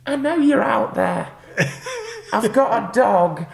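high, loud, drawn-out woman calling out; eerie woman's voice. Retold or imitated. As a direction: call slowly into the dark, high and loud. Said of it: "and then this voice goes".

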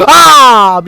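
startled yelp. Real, not acted. Scare while searching room.

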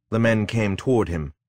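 plain reading voice

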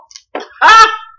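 big high-pitched burst of laughter; real loud laugh. Real, not acted. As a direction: howl with laughter. Cracking up at son's show.

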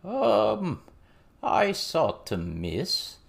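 slow, drawn-out frog-like goblin voice; slow froggy storyteller drawl; croaking goblin witness. A narrator's reading. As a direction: tell it slowly, croaking like a goblin. Goblin character continues his account.

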